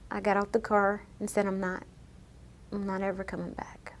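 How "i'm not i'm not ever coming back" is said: soft, breathy whisper of finality; quietly whispered goodbye; whispered farewell. Retold or imitated. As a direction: whisper it as you walk away for good. Final words leaving at airport.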